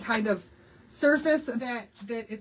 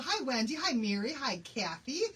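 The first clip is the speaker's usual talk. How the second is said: ordinary talk, nothing marked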